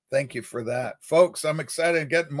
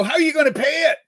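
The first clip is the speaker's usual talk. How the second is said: mock question; 10 semitones higher, 5 dB louder, much faster than usual. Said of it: "and they go".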